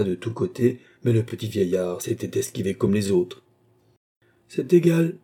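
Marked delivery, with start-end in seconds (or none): 4.5-5.2 high, loud, gloating exclamation; triumphant old marshal's cackling tone; sneering chuckle. A narrator's reading. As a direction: crow it with a snicker. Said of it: "dit le maréchal en ricanant".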